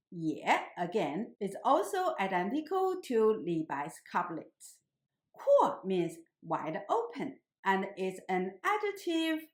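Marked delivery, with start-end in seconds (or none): 5.3-5.8 Kuo with a falling tone; fourth tone. Shown as a demonstration. Teacher showing students Kuo in the fourth tone. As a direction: say Kuo starting high and dropping. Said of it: "pronounced in the fourth tone".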